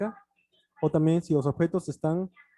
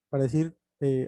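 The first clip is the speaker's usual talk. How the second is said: normal talking voice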